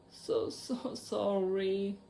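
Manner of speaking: slow, tearful apology; sobbing. A narrator's reading. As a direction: choke it out through tears. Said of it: "sobbed Hagrid".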